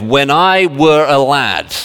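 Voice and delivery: slow, drawn-out Yorkshire imitation; Yorkshire accent. Preacher quoting northern jokes, mock Yorkshire accent. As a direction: put on a broad Yorkshire accent. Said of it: "they actually have a different accent up there".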